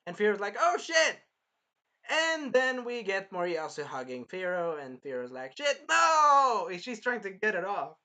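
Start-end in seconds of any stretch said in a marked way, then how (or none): 0.6-1.2 high, loud acted outburst; mock alarmed yell. Retelling Firo chained up, mock alarmed yell. 5.6-6.7 loud, high drawn-out refusal; mock protest yell. Firo being hugged by Moriyasu, mock protest yell.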